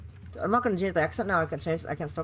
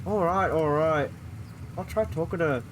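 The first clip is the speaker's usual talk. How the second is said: weary father; 3 semitones lower than usual. Acted. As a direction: sigh it out like a tired dad. Father gives in to mother.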